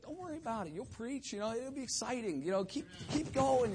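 light, high-pitched mock reassurance; imitated encourager. Voicing woman's cheering reassurance.

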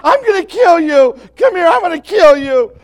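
threat roared at top volume; furious yelling. Shown as a demonstration. Preacher contrasting calm with rage, yelling. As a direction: scream it like you've lost control. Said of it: "Then you go".

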